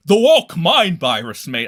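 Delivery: loud, drawn-out mock Elon Musk accent. Streamer mocking Elon's tweet in an exaggerated Elon accent. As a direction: punch it out slowly in a put-on Elon accent. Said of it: "giving him this fucking accent".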